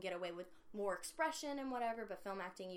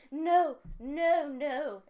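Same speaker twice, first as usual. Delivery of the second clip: protesting woman; 7 semitones higher, 11 dB louder, much slower than usual. Retold or imitated. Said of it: "The woman's like".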